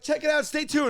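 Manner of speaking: high-pitched TV presenter patter; mock upbeat promo; show host intro. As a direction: tease the show like a perky host. Re-enacts co-hosts' upbeat intro.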